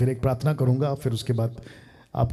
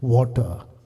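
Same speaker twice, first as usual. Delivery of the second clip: forceful, raised preaching voice; 8 semitones higher, 9 dB louder than usual. Real, not acted. Preacher to congregation about accepting humble provision, forceful preaching. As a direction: preach it with punched key words.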